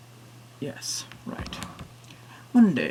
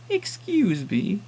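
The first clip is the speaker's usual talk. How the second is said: put-on old woman; 8 semitones higher, much slower than usual. Old woman voiced in story.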